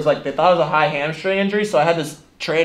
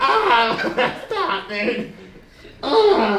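being tickled; 7 semitones higher, much slower than usual. Real, not acted.

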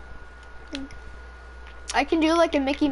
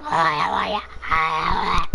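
much lower put-on cartoon voice; imitating Hayden's voice; 9 semitones lower than usual. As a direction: copy a friend's goofy low voice. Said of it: "He's like".

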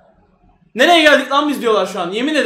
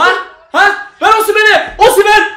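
high, loud call for the pass; urgent shout; 7 semitones higher, 5 dB louder than usual. Real, not acted. Fan demanding a pass to Osimhen, urgent shout. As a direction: yell for the ball to reach him. Attack building.

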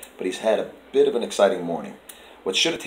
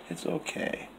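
whispered reassurance to a scared cat; a hushed it's okay; soothing whisper; 6 semitones higher, 11 dB quieter, slower than usual. Real, not acted. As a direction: breathe it softly so he won't startle.

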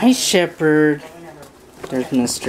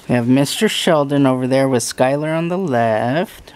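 usual speaking voice

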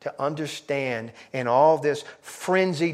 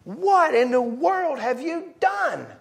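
loud, high exasperation; exasperated Pilate; 10 semitones higher, 10 dB louder than usual. Acted. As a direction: wave him off, baffled. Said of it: "Pilate is saying".

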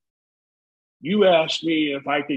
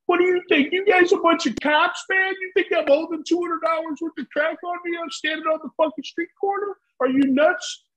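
suspicious street dealer; 12 semitones higher than usual. Retold or imitated. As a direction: play the dealer scoffing at the cops idea. Street dealer voiced in story.

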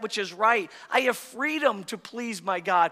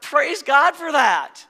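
higher, louder, slow exclamation; joyful praise; 8 semitones higher, 9 dB louder, slower than usual. Real, not acted. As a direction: exult over it.

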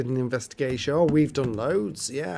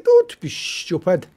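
mock Cat telling off; 2 semitones higher, 7 dB louder, slower than usual. Friend quoted scolding her, lightly mock scolding. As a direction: play the friend snapping back at her. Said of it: "She said".

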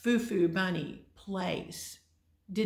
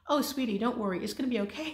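higher, mock-soothing reassurance; comforting sweetie; 6 semitones higher, 4 dB louder, faster than usual. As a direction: pat the crying doctor on the back with your voice. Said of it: "I'm patting him on the back going".

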